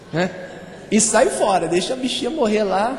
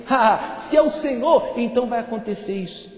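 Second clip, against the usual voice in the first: mock challenge. Preacher re-enacts Peter testing God, mock challenge. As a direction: call it out as a hammed-up test. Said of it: "ele fez uma prova com Deus".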